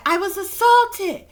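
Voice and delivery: high, loud, slow imitated outburst; imitating her cry of assault. Retelling the video, he cries out her words loud and high. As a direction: belt it out high as she did. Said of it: "she said".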